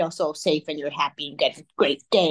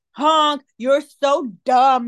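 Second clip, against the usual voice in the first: rude horn voice; 5 semitones higher, 5 dB louder, much slower than usual. Retold or imitated. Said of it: "It's like".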